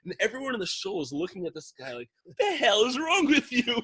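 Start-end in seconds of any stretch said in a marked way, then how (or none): 2.2-3.8 incredulous crowd. Retold or imitated. Shul crowd scolding the man.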